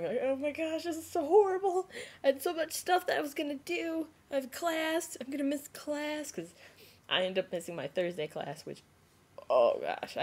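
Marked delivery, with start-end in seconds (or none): 0.2-6.3 high-pitched mock crying; tearful wailing. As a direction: whine it as if in tears. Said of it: "Meanwhile, I'm just crying".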